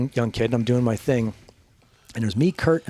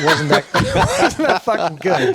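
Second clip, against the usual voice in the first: laughing; 5 semitones higher, 6 dB louder, slower than usual. Real, not acted. Laughing at his own story.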